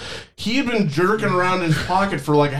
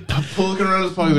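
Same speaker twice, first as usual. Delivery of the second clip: ordinary talk, nothing marked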